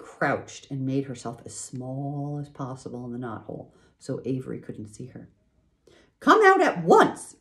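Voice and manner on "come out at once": much higher, louder, slow cry; mother crying out to her son. A narrator's reading. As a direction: call him out sharply. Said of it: "cried Missus Arabelle".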